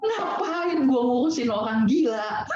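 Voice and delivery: high, slow amused self-talk; laughing inner thought. Retold or imitated. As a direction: chuckle at the absurdity. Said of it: "Karena saya ngerasa".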